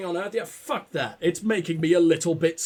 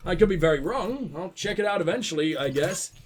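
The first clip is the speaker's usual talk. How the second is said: ordinary talk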